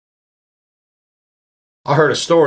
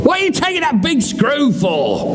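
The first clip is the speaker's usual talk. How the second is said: wife voice; 9 semitones higher than usual. Retold or imitated. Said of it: "the wife going".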